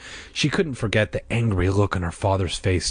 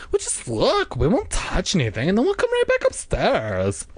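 high-pitched sing-song coaxing; insisting; 11 semitones higher than usual. A narrator's reading. Diane promises not to touch, high and insistent. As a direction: coax in a high girlish voice. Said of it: "Diane insisted".

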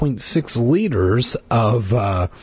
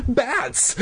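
tiny high-pitched squeak of fright; little frightened yelp; terrified tiny voice; 12 semitones higher, 4 dB quieter than usual. A narrator's reading. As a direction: cry it small and high, terrified. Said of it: "cried in a terrified, tiny voice".

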